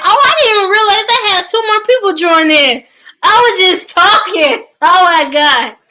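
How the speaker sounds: real startled excitement, rushed and loud; excited surprise. Real, not acted. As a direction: caught off guard by new listeners. Notices new viewers joined.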